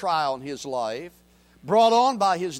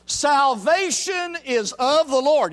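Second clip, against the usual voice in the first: slow, much higher, louder proclamation; Jonah's cry; 9 semitones higher, 8 dB louder, slower than usual. Retold or imitated. Jonah in the fish, crying out to God. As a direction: cry it out from the depths. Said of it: "he closes his prayer with these words".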